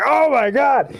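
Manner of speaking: high, drawn-up mock horror; retold shock. Telling co-host about over-the-top deaths, high mock-horrified exclamation. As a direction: exclaim aghast, pitch leaping up. Retelling reaction to another death.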